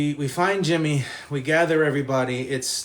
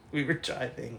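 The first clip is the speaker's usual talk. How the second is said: laughing through words; 3 semitones higher, 10 dB quieter than usual. Real, not acted. Laughing retelling.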